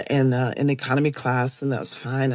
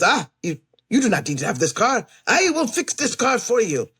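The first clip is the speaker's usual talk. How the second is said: put-on heavy Eastern European accent, emphatic; 5 semitones higher than usual. Man at rental car lot offering to fix her car, heavy Eastern European accent. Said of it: "Eastern European accent".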